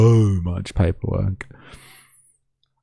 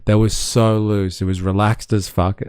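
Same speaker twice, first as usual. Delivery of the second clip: ordinary talk, nothing marked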